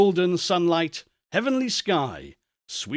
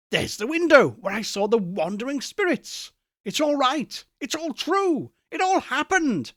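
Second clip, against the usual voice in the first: climbing higher with each line; building excitement; 5 semitones higher than usual. Acted. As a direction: build to giddy disbelief. Scrooge rising in excitement.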